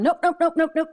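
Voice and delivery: sing-song mocking of stubborn Democrats; mock refusal. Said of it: "they double down on".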